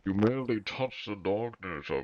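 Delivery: masked villain, put-on muffled timbre; theatrical masked-villain impression; Bane. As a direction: play the villain as if talking into a cup. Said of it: "try and do a Bane impression".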